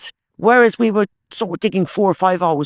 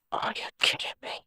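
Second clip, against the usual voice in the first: disbelieving whisper; 13 dB quieter than usual. Real, not acted. Disappointing find while detecting.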